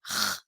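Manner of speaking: a lone throaty H-like R; guttural R. Shown as a demonstration. Teacher isolating the sound for Rio children, guttural R. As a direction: scrape a short R at the back of the throat. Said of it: "ensinar as minhas crianças o som".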